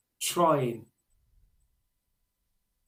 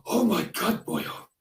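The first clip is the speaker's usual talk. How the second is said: light Welsh headmaster exclaiming, higher; a hint of a delighted Welshman; Welsh accent; 5 semitones higher, slower than usual. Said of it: "he's from Wales".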